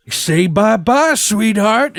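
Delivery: sing-song mock threat; mock taunting villain. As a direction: imitate a villain's slow taunt. Hustler taunts her before firing.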